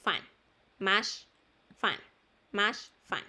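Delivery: "Much" and "fan" with open "ah" vowels. Shown as a demonstration. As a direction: model "Much, fan" word by word, as "mach, fan". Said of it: "este U se lee como A". ("Much, fan" con la U como A)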